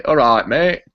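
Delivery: "All right, mate." in broad Yorkshire vowels; Yorkshire accent. Shown as a demonstration. Brit from Yorkshire demoing his home greeting. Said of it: "which is Yorkshire, you'd say".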